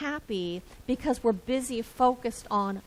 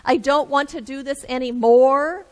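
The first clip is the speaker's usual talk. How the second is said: whiny complaint; 3 semitones higher, 9 dB louder than usual. Retold or imitated.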